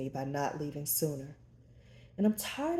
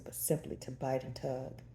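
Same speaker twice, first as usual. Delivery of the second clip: ordinary delivery, nothing marked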